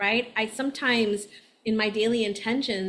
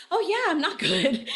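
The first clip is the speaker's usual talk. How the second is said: higher, giggling fake-fine reply; laughing self-quote; 7 semitones higher than usual. Astrologer mimicking her own cheerful denial with a laugh. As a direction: laugh it off like everything's okay.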